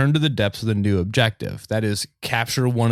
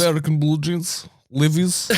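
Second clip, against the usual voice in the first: higher, hammed-up Russian accent; mock Russian accent; 5 semitones higher than usual. Joking about Soviet exports, higher hammed-up Russian accent. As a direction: relish it in a cartoon Russian accent. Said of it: "talk in a Russian accent".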